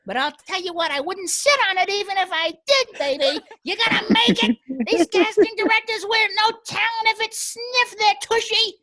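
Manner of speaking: put-on loud, high old-woman character voice; Agnes. Comic as Agnes pep-talking his partner, loud high old-lady voice. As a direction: belt it out high as a feisty ninety-seven-year-old. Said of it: "I need some Agnes".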